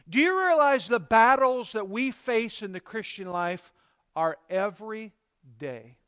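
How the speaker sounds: normal talking voice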